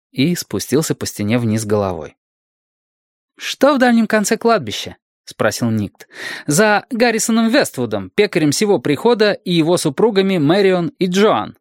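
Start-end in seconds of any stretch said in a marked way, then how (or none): 3.4-5.0 put-on high child's voice, questioning; high-pitched inquisitive child; curious little boy. A narrator's reading. Boy questions his guardian. 6.5-11.6 put-on high child's voice, precise; high-pitched boy spelling out his question; inquisitive little boy. A narrator's reading.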